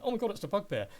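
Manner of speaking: high, loud jolt of panic; startled alarm. Acted. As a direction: recoil in sudden fright at a monster. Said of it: "that kind of jerk reaction of".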